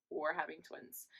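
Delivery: softly whispered news; hushed confirmation. Retold or imitated. Telling boyfriend about the twins, hushed half-whisper. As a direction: let it out under the breath.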